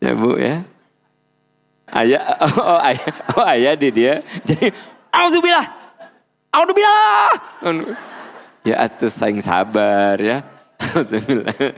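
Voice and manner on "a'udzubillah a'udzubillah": hammed-up yell, far higher and louder; the wrong way, angrily shouted; mock shouting. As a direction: scream the prayer in exasperation. Mocking angry recitation.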